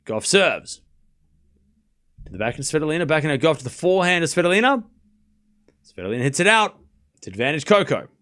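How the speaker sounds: brisk running commentary, rising at each name; live play-by-play. Acted. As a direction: call the rally as it happens.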